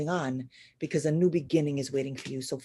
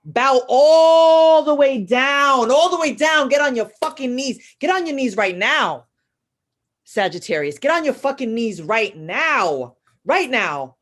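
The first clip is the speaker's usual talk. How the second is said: forceful command; 6 semitones higher, 8 dB louder than usual. Real, not acted. Commanding Sagittarius to kneel, loud and high. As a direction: order them down to their knees.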